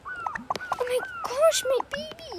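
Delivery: very high, slow wail of a mother; high, drawn-out cry of shock; mock panicked mother. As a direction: squeal it out like a frantic mom. Mother finds her daughter unconscious.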